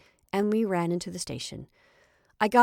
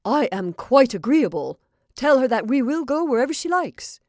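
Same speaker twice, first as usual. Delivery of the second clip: loud, higher and eager; can hardly wait to agree; excited; 6 semitones higher, 9 dB louder than usual. A narrator's reading. Paul to his friend, loud and eager about the woman. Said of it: "who was very much excited".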